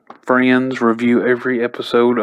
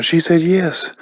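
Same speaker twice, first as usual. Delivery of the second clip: higher-pitched, thrilled announcement; excited; 6 semitones higher than usual. Retold or imitated. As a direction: imitate a friend running over with big news. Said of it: "runs over excitedly going".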